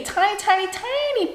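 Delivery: high-pitched little voice on the words; tiny squeak. Retold or imitated. Hospital staff warning of a minute broken bone, squeaky emphasis. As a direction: shrink the voice upward to show smallness. Stressing how small a break is.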